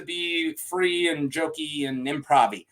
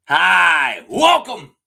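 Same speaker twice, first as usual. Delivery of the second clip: loud greeting; 9 dB louder, much slower than usual. Real, not acted. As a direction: shout the welcome like opening a show. Channel intro called out.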